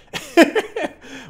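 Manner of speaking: short airy laugh; breathy laugh. Real, not acted. Laughs at feeling like celebrity.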